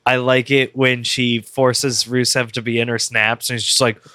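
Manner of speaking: ordinary talk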